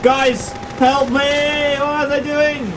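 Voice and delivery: high, slow wailing plea; drawn-out high-pitched distress; panicked pleading. Real, not acted. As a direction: whine for help, drawing words out. Pleading with enemies and viewers.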